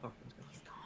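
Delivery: hushed whisper. Real, not acted. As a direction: whisper it under your breath. Whisper, unvoiced.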